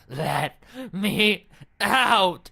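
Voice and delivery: a trapped soul screaming to get out; panicked scream. Acted. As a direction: scream to be freed.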